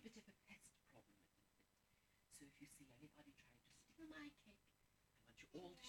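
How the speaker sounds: conspiratorial whisper to the crowd. Acted. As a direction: let the kids in on a secret. Secret plan with the audience.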